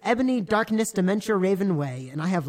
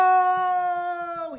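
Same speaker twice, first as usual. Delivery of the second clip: high, loud dramatic shout; 12 semitones higher, 4 dB louder than usual. Acted. Dumbledore shouting at Snape and Lupin. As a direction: belt out a melodramatic no. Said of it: "he shouted".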